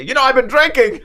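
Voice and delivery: high, loud blurted confession; mock blurting. Driver imagining confessing to the officer, loud and high. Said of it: "and not say".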